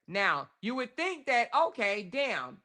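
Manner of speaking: ordinary delivery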